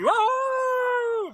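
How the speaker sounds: long, very high howl held on one note; werewolf howl. Acted. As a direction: throw your head back and howl like a wolf.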